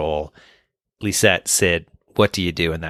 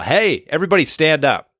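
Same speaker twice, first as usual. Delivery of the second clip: high, raised call to the room; demonstrated yell; 7 semitones higher, 4 dB louder than usual. Shown as a demonstration. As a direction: yell to get everyone on their feet. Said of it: "if you stand up and yell".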